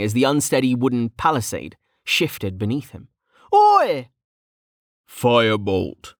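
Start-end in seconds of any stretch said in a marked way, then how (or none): 3.5-4.1 high, loud cry of protest; indignant shout. Acted. Mage nearly shoved off the palisade, indignant shout. 5.1-6.1 slow, measured spell casting; deep, calm voice. A narrator's reading. Rival fire mage casting with ease, deep and calm. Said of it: "said a deep voice calmly".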